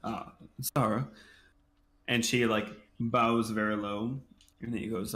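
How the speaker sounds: ordinary delivery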